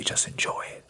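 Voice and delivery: faint imitated whisper, almost no voice; whispered from far away; barely audible mouthed words. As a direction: breathe it as if only the lips move. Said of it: "I can see his lips".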